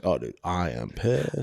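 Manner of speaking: low, slow, quiet grumble of being pissed; understated mock anger. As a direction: play the anger deadpan and held in. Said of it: "I'm just like".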